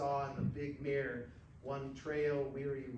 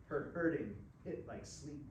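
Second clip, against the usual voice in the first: usual speaking voice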